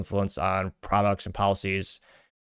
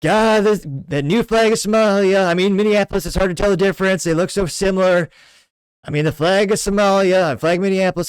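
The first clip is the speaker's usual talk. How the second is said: much higher, louder put-on Trump voice; Trump impression; 10 semitones higher, 7 dB louder than usual. Imagining Trump judging the flags, loud hammed-up impression. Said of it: "if Trump were to look at these flags, he'd say".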